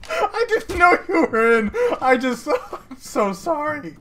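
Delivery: giggling through the apology; laughing apology. Real, not acted. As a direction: laugh while saying sorry. Teamkilled a friend, apologising.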